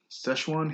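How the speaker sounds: Szechuan said as "SESH-wahn"; own pronunciation. Real, not acted. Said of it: "That's how I pronounce that".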